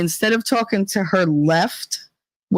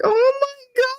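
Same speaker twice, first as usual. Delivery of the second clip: shocked gasp. Retold or imitated. Everyone reacting to the standoff.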